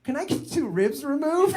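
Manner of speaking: put-on high, hopeful child's request; high-pitched mock-innocent kid; kid asking mom. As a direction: lift it high into an eager question. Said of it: "And you'd be like".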